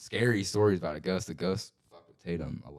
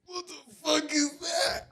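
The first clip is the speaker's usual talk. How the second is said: very high, drawn-out squeal; high-pitched, hammed-up fright; mock scared squeal; 3 dB louder, slower than usual. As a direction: squeal it slowly, imitating a frightened kid. Retold childhood fright at shadow.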